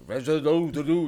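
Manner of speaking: mock Russian gibberish. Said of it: "a Russian dude that just comes up to you and goes".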